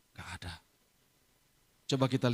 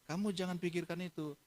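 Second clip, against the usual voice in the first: softer, higher fatherly reassurance; gentle reassurance; 4 semitones higher, 6 dB quieter than usual. Retold or imitated. Father telling his daughter not to worry, gently reassuring. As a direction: ease her worry softly.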